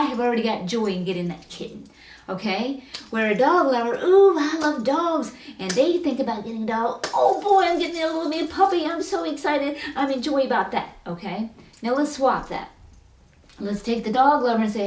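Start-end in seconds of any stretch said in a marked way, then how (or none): 4.0-5.3 high, loud, slow drawn-out ooh; gushing dog lover. Retold or imitated. Dog lover dreaming of a puppy, slow delighted coo. 7.1-11.0 starts much higher and louder, settles as it goes; excited dog lover. Retold or imitated. Dog lover told a puppy is coming, gleeful rush.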